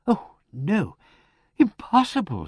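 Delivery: high, dismayed protest; shocked disbelief. A narrator's reading. Vicar hearing of a death, shocked disbelief. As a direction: refuse to believe it, pitch lifted.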